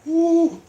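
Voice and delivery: high, loud ooh of pain; pained cry. Real, not acted. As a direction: wail as the burn peaks. Burning pain from hot pepper.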